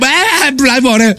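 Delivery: mocking call. Everyone who saw him called it out, mocking imitation. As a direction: imitate everyone hooting a nickname. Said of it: "Y todos me veían y dicen".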